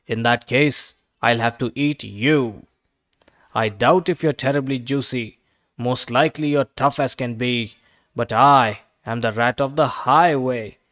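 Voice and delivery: loom over the duck hungrily; menacing rat. A narrator's reading. Rat threatens to eat duck.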